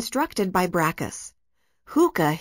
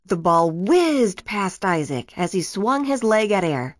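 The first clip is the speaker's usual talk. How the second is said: ordinary delivery, nothing marked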